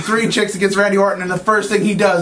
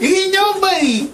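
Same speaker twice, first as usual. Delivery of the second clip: much higher, laughing, put-on voice; dismissive quip; 13 semitones higher than usual. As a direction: toss it off like he's nobody. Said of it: "I'm just sitting there going".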